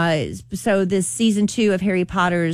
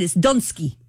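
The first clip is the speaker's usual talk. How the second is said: mock Russian. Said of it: "Kelly, you wanna do your Russian?".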